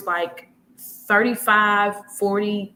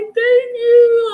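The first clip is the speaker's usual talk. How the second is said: crying thanks; 9 dB louder than usual. Retold or imitated.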